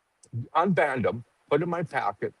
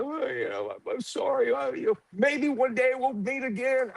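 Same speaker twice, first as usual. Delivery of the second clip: mock tearful goodbye; 11 semitones higher than usual. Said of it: "And I was crying".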